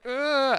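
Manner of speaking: high, loud mock grunt of effort. Game master acts out firing off the spell, effort grunt. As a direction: let out a sudden high, loud "ugh". Acting out the spell being fired.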